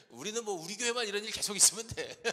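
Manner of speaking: loud, high, chuckling delivery; amused quip breaking into a laugh; laughing joke. Real, not acted. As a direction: tease the congregation through laughter. Pastor jokes and laughs with congregation.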